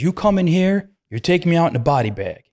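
high yelled threat; threatening kid. Retold or imitated. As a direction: scream the threat through the door. Kid threatening through door.

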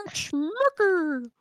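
Mickey Mouse. Acted. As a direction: chirp it like the cartoon mouse. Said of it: "I can do, like".

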